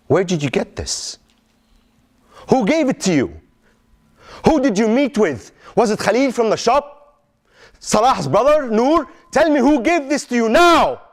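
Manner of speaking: pressing harder, pitch climbing to a shout; frantic father demanding who gave it; rising angry interrogation. Acted. Father interrogates son at night.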